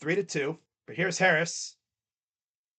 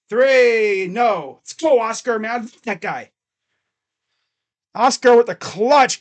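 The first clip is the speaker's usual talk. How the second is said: louder announcer-style call that rises higher near the end; live play-by-play; 4 semitones higher, 8 dB louder than usual. Acted. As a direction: narrate each pass like a broadcaster. Streamer calls the game live.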